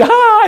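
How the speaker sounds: very high, loud mock laugh; imitated laugh. Teacher acting out helpless laughter in class, high mock laugh. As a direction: let out a pretend shriek of laughter. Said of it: "그럼 나도 웃어".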